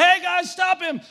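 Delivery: high, loud call to the guys on the bluff; calling out for help. Retold or imitated. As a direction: yell for someone to stop him.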